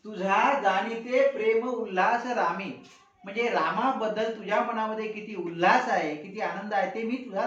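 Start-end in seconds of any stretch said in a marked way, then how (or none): 0.0-2.7 measured, slow recitation of the verse; teacher reciting a poem line slowly; slow poem recital. Acted. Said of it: "या ओळीचा अर्थ पाहू".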